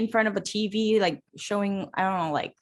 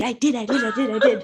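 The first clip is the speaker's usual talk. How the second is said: laughing admission; 4 dB louder than usual. Real, not acted. Admitting it, laughing.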